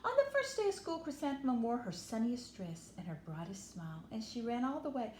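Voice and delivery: plain reading voice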